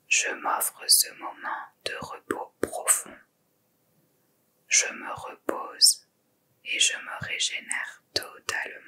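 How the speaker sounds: faint inner whisper. Shown as a demonstration. Guide repeating the Sankalpa inwardly, barely audible whisper. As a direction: let it fade as if said only inside. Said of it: "vous le répétez trois fois intérieurement".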